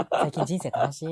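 loud, chummy recruiter, laughing; scammy recruiter. Acted. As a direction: lure the mark sweetly. Acts out a scam recruiter's line.

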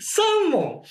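high, disbelieving cry; incredulous exclamation. Real, not acted. Player learns they got only three, incredulous. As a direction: blurt it out high, as if it can't be true.

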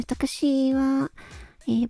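usual speaking voice

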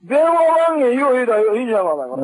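furious, falling away at the end; angry shouting. Real, not acted. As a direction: shout the demand down the phone. Angry demand in phone quarrel.

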